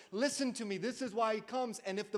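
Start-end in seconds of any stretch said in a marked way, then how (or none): none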